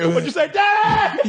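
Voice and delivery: high, slow shouted question; high mock reply. Dog squealing back at the kangaroo.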